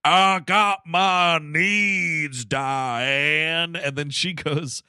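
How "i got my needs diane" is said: very slow, drawn-out character voice; Craig T. Nelson. Acted. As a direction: stretch each word in a put-on voice. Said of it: "doing a little bit of a voice here".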